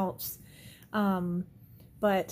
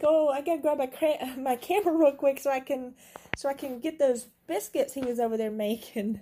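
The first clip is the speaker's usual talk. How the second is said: retold excitement; 4 semitones higher, 6 dB louder than usual. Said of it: "I was like".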